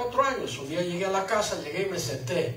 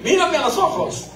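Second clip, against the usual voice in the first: higher and louder, demanding eye contact; firm command; 8 semitones higher, 7 dB louder than usual. Shown as a demonstration. Wife telling husband to look at her, a louder firm command.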